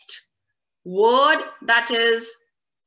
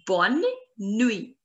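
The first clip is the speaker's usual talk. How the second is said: Bonne and nuit set apart by a pause; word by word. Shown as a demonstration. Teacher repeats good night for learners, Bonne nuit in two pieces. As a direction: split Bonne nuit into two careful words.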